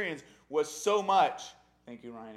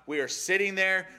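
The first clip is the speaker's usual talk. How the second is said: usual speaking voice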